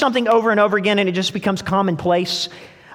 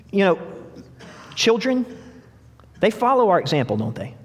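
ordinary talk, nothing marked

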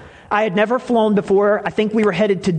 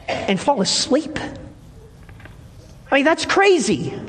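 preaching; 5 semitones higher than usual. Acted. Preaching about Jonah asleep.

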